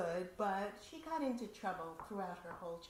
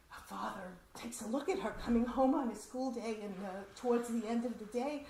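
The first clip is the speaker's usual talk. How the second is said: normal talking voice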